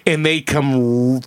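ordinary talk, nothing marked

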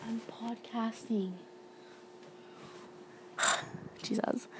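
whispered aside to someone in the room; exasperated whisper with a sigh; hushed aside. Real, not acted. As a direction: drop to a whisper, annoyed at the interruption. Someone interrupts her recording.

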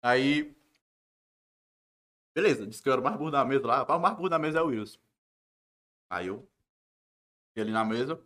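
talking with a mouthful of food; chewing while talking. Real, not acted. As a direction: speak through a bite of food. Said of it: "Vai comendo e falando com a boca cheia".

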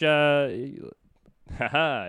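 ordinary talk

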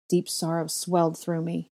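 ordinary delivery, nothing marked

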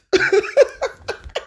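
airy high laugh, barely voiced; breathy laugh. Real, not acted. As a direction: laugh under your breath. Laughs at own joke.

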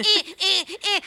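loud, high repeated squawks; bird squawks. Retold or imitated. Storyteller imitating birds answering each other, aggressive squawking. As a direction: imitate a flock yelling in alarm. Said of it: "like, aggressively yelling at us".

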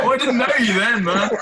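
loud, high-pitched amused protest; laughing protest. Real, not acted. Guest answering the handyman's late offer, loud laughing protest. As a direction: shout it back through the laughter.

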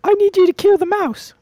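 tiny high-pitched insect voice; high squeaky cricket voice. Acted. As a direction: squeak out the order.

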